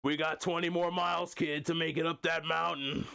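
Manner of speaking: mock drill-sergeant dad pushing the kid on; Jon Moxley. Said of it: "conversations with your dad that are like this".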